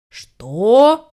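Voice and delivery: drawn-out, rising indignant question; indignant dog. A narrator's reading. Dog affronted by the puppy's request, indignant. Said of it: "возмутился пёс".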